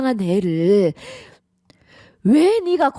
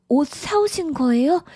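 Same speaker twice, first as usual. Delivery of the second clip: normal talking voice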